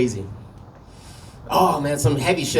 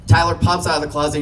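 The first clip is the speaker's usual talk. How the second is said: ordinary talk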